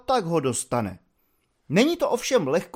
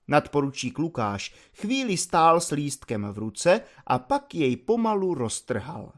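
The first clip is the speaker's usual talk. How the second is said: ordinary delivery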